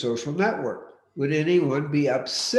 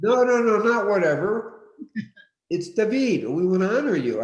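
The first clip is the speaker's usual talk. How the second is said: raised, higher-pitched firm objection; emphatic insistence; 7 semitones higher, 5 dB louder than usual. Real, not acted. Host honoring a participant's name, emphatically insistent.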